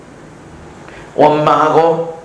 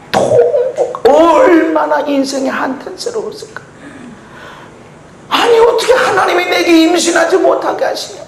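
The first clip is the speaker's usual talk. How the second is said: high, loud fervent preaching; preaching at full force; 14 semitones higher, 3 dB louder than usual. Acted. Preacher crying out about the valley of tears, fervent. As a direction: lift it up like a lament.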